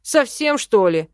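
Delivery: loud, offended outburst; outraged elf snapping back; indignant. A narrator's reading. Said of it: "возмутился эльф".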